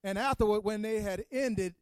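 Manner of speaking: Good Friday preacher pushing toward the climax; preaching. Acted. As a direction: preach it to the congregation, gathering steam.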